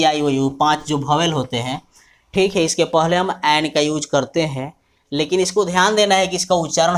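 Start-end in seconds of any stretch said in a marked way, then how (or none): none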